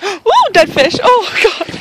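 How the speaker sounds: startled, grossed-out gasp; real shock at dead fish; disgusted gasp. Real, not acted. Gasps at dead fish in creek.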